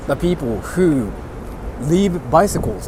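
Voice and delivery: ordinary talk, nothing marked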